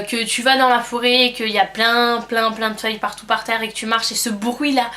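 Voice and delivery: ordinary delivery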